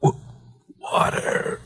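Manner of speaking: voiceless, broken, soft; faint whisper. Acted. Semi-conscious man begging again for water, faint broken whisper. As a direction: struggle to get the word out.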